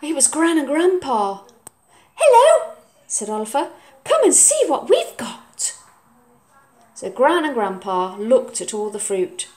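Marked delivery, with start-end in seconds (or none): 2.2-5.7 high, loud greeting; higher, louder child; excited boy. Acted. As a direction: burst with news. Oliver's voice.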